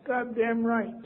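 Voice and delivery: high-pitched, drawn-out reply; high mock bravado; retold surprise voice. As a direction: let a small high voice answer for you. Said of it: "I heard a voice say".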